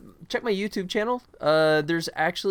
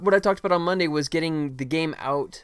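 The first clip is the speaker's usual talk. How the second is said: ordinary delivery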